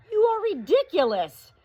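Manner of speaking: high, loud scolding; exasperated sister. Acted. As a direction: throw the words at him. Sister snaps at doctor.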